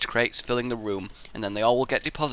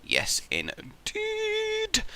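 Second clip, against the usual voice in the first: mock yes indeed; 7 dB quieter, much slower than usual. Drawn-out mock sage reply.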